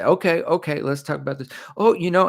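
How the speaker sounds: high-pitched teasing stall, breaking off; Seth James Dumore. Retold or imitated. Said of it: "tease it out. He'll say".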